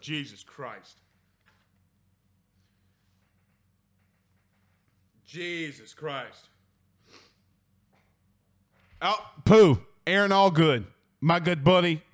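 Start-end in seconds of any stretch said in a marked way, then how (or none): 0.0-6.5 low, hushed disbelief under the breath; stunned low mutter. Real, not acted. Host reacting to a tense finish, hushed disbelief. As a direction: drop way down, almost to yourself. Reacting to close game score.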